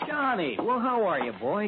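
high, delighted welcome; hearty greeting. Acted. Insurance man welcoming Johnny into his office, delighted. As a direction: greet an old friend with gusto. Colleague greeting old friend.

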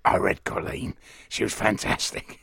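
hoarse, unvoiced rasp; slow, husky growl of an impression; Sean Dyche. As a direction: rasp it out with almost no voice. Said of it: "I could do it like Sean Dyche".

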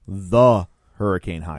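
The set apart and drawn out; stress on The. Real, not acted. Host savors the title as the only one, stress on The. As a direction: lean hard on The, pause before Hurricane. Makes a point of the article.

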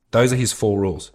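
normal talking voice

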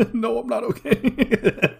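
high, slow squeaky laughing voice; mock teary reply through laughs. Friend mimicking Peter's teary answer, laughing and high. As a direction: laugh out a whiny not okay.

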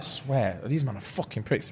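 low, soft grumble; muttered grumble. Pretend. Referee grumbling about the players, low soft mutter. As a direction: grumble it to yourself.

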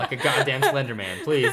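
loud mock protest amid laughter; teasing complaint. Real, not acted. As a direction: plead loudly, half laughing. Teasing friend about his long arm.

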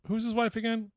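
ordinary talk

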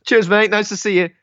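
high, loud fake-friendly voice; mock cheery greeting. Acted exchange with neighbour.

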